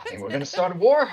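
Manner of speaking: high goofy Boston ape voice; Boston accent. Retold or imitated. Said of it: "an ape with a heavy Boston accent".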